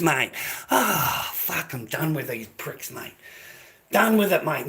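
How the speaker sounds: normal talking voice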